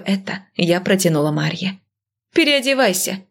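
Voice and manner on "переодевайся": high-pitched brisk order; commanding. A narrator's reading. Doctor orders her friend into scrubs, commanding. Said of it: "скомандовала".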